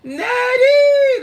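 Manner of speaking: high, loud tearful cry of the name; Color Purple crying shout. Retold or imitated. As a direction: wail the name like the movie. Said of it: "the first one".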